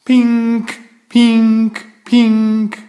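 high, loud electronic pink pink pink; shrill beeping alarm call; alarm ringing. Acted. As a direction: ring it out like an alarm clock. Alarm clock ringing sound.

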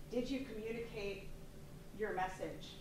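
ordinary talk

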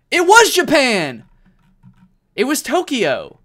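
loud, high, slow shout of triumph; excited outburst. Real, not acted. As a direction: cry it out like you nailed it. Guessed right, excited reveal.

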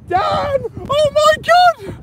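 very high, stretched-out scream of joy; ecstatic shriek. Real, not acted. Angler screaming to Dan at the giant tope, ecstatic. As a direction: lose it completely at the sight. Fish comes into view.

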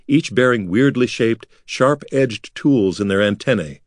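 ordinary delivery, nothing marked

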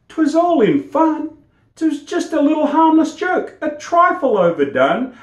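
barber. Acted. Barber excuses himself.

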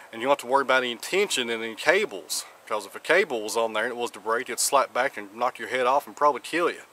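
ordinary talk, nothing marked

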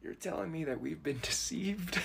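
unvoiced whisper of betrayal; hushed disbelief. To the barista, whispering in mock betrayal. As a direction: breathe it as if you've just learned the truth.

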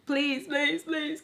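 high, quiet whimpering plea; retold begging. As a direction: beg in a small mock whine. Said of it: "I'm just like".

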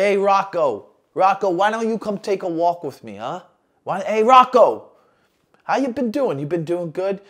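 mock mafia boss, falsely friendly; lightly voiced gangster luring a friend; movie mobster. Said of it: "from all the legendary mobster movies".

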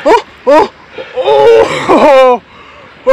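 high loud yelps from the cold; cold water yelps. Real, not acted. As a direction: gasp and yelp in icy water. Entering icy water.

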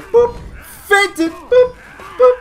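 high, punchy boop voice; playful squeak. Pretend. Player showing his planned trick, high silly squeak. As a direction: squeak out the fake move.

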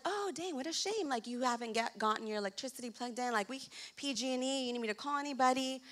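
softly acted neighborly concern; hushed mock sympathy. Neighbor offering help with a dark house, hushed sympathy. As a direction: drop well down in volume, gently pitying.

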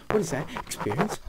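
a sudden startled double take; surprised. Real, not acted. Spots something odd in game.

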